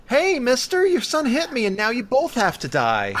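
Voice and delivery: very high, cute voice delivering a threat; sing-song little kid promising doom; mock sweet child menace; creepy child threat.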